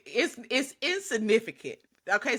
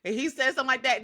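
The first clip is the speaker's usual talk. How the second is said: normal talking voice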